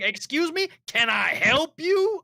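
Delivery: high, drawn-out indignant questions; affronted challenge. Retold or imitated. Homeowner opening the door on the pest control guy, affronted. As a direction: mimic a homeowner confronting an intruder, high and pointed. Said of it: "They're like".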